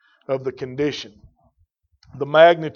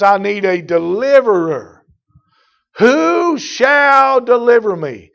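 louder, higher, slow drawn-out plea; pleading preaching; 7 semitones higher, 9 dB louder, slower than usual. Acted. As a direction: cry out for a deliverer, slowly. Preaching the verse.

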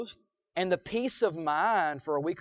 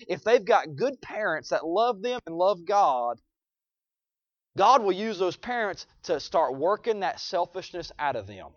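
usual speaking voice

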